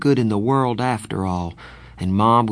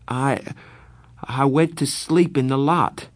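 stammering; 6 semitones higher than usual. Acted. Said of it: "when I stammered".

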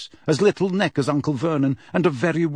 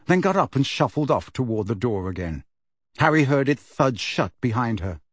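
ordinary delivery, nothing marked

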